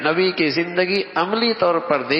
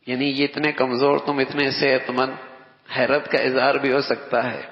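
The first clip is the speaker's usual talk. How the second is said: usual speaking voice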